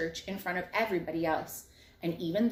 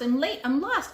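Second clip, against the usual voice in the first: yelling; 7 semitones higher, 5 dB louder than usual. A narrator's reading. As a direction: keep calling, high. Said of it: "She was yelling".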